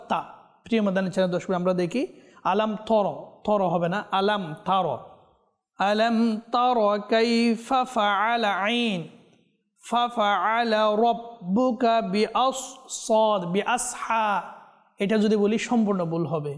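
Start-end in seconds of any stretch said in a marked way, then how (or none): 5.8-14.4 slow, melodic tajweed chant; slow chanted Quranic recitation. Shown as a demonstration. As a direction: draw out each Arabic syllable in measured recitation.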